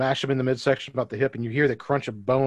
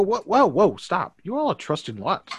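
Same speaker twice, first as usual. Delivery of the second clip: startled objection; 6 semitones higher than usual. Acted. Guard telling the party to stop, startled. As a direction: start high, then ease down. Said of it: "She's like".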